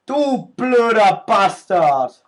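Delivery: slow, loud, high furious shout; drawn-out angry curse; angry yell. Real, not acted. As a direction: let the insult rip at the enemy. Cursing an enemy in game.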